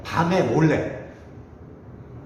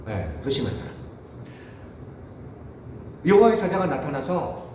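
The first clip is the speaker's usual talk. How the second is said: normal talking voice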